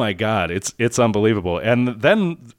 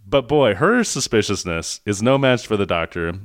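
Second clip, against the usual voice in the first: ordinary delivery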